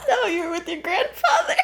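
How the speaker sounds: very high, helpless laughing retort; real laughing fit. Real, not acted. Host teasing the co-host about the grandfather, laughing hard. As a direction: squeal it out through laughter.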